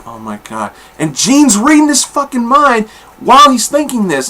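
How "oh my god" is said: awed hush. Retold or imitated.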